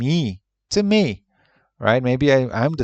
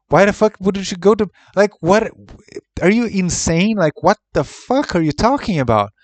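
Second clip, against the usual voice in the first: higher, louder incredulous rant; exasperated outburst; 6 semitones higher, 3 dB louder than usual. Real, not acted. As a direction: blurt it out in disbelief. Ranting at reviewers' absurd logic.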